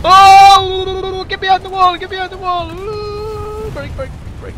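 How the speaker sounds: high loud battle yells; panicked shouting. Real, not acted. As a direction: scream orders under fire. Panicked firefight calling out.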